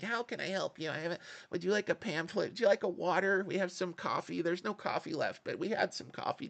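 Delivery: mock bank clerk fussing over coffee; big banker voice. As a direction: play a falsely helpful clerk offering pamphlets and coffee. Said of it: "doing my big banker voice".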